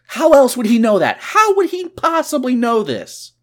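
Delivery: high-pitched, incredulous outburst; exasperated disbelief. Acted. Host questions a plot hole, loudly incredulous.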